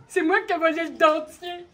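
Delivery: loud, proud confession; loud boast. Acted. The laughing thief proudly confessing to René. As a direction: announce it proudly.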